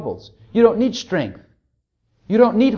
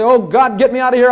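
raised, loud panicky plea; mock panic; 5 semitones higher, 6 dB louder than usual. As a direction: imitate a panicked prayer for rescue. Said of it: "I don't have to panic and say".

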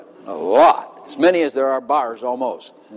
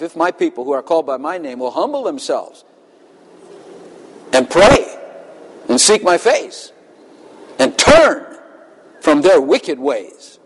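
preaching recitation. Acted. Reciting a verse with force.

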